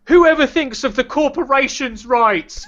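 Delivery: mock a pompous defender. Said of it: "it's not like I'm like".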